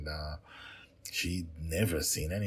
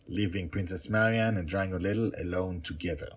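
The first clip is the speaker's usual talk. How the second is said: ordinary delivery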